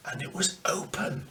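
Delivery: whispered, breathless reveal; hushed reveal. A narrator's reading. Narrator revealing the open tunnel lid, in a whisper. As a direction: drop to a whisper for the shock.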